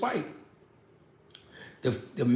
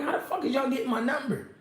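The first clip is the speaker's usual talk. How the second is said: much higher, stunned and half laughing; incredulous; 9 semitones higher, faster than usual. Retold or imitated. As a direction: throw it out in amused shock. Baffled by matchmakers calling him.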